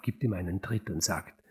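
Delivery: ordinary delivery, nothing marked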